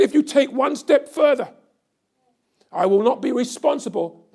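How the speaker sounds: loud, high cry of the father's warning; fiery preacher's shout of the threat; preached shout of the warning. Retold or imitated. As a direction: thunder the warning out. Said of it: "And when he said".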